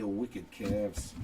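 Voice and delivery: lightly put-on Boston accent. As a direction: slip playfully into a Boston accent. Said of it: "say it with a Boston accent".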